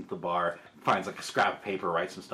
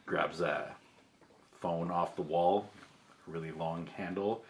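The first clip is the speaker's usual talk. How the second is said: ordinary talk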